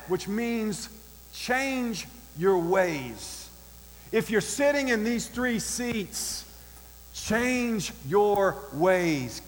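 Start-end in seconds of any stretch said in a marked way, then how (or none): 1.3-3.5 each word drawn right out; very slow, deliberate admonition; emphatic slow. Real, not acted. Preacher drives home meaning of repent. 7.1-9.4 word-by-word, heavily stretched warning; very slow, deliberate command; emphatic slow. Real, not acted. Preacher repeats the admonition.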